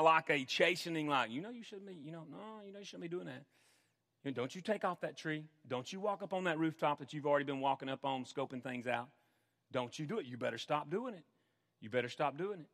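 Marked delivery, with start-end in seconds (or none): 1.3-3.4 much softer, almost hushed, gentle inner voice; Holy Spirit's gentle, much softer warning; still small voice. Acted. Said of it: "It's a still small voice". 4.2-12.7 softer, lower, gently warning; a quiet, loving caution; Holy Spirit softly cautioning the believer; still small voice. Acted. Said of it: "It's a still small voice".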